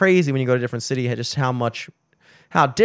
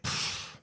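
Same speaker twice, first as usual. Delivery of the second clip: a breathy dismissive pfft, no voice; unvoiced pfft scoff; 16 dB quieter than usual. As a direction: scoff like a sulking partner. Mimics a dismissive scoff in argument.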